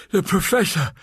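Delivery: high, breathless frightened gasp. Acted. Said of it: "she gasped".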